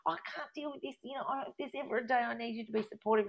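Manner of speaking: high-pitched, exasperated outburst at mum; retold argument. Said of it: "my mum and I have this big argument".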